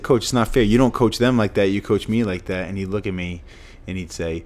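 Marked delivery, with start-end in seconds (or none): 0.0-2.7 louder, protesting to the coach; retold complaint. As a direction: push back at the coach loudly. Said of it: "I said".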